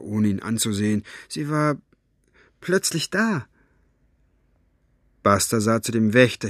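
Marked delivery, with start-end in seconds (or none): 1.3-3.5 higher, halting evasive answer; blurted out. A narrator's reading. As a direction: answer haltingly under pressure. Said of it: "stieß Maggie hervor".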